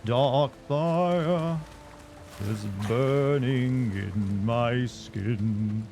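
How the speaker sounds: put-on slow old man singing voice; Frollo voice. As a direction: sing it as a stern old judge. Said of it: "I can do old man voice".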